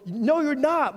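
a believer protesting his friend's claim, loud and high; indignant retort. Pretend. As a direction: snap back in disbelief. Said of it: "everything we wanted to go".

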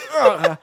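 loud laughing groan of a spent driver; mock exhausted groan. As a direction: groan like you can barely lift the trophy. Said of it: "He's like".

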